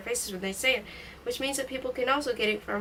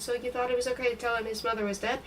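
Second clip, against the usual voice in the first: ordinary delivery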